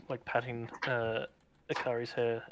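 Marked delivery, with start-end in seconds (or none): none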